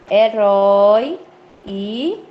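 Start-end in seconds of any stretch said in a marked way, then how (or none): 0.1-1.2 "herói" slow and louder on open ói; emphatic, drawn-out "herói"; open diphthong. Shown as a demonstration. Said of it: "eles são ditongos abertos".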